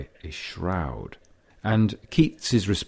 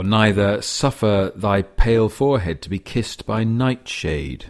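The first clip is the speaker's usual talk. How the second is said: louder, declaimed verse line; poetry recitation; 3 semitones higher, 6 dB louder than usual. Acted.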